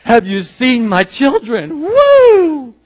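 high, loud and slow, ending in a soaring whoop; a proud showman calling to the whole crowd; God bursting into song and dance, then a shrieking "Woo!"; joyous God showing off. Pretend. God breaks out into a musical.

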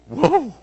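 high, loud startled "whoa"; sudden whoop of surprise. Retold or imitated. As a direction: imitate a sudden jolt with a whoa. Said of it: "it was like this".

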